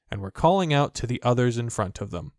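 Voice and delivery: usual speaking voice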